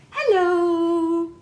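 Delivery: high, loud hello with a long stretched O; imitating Aunt Renee. Mom copying how Aunt Renee greeted the baby, stretched-out hello. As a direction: sing out "Hello." with lots of O's. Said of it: "Like that, with a lot of O's".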